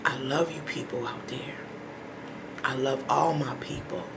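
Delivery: soft, low, near whisper; tender sign-off. Real, not acted. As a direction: say it gently to your people.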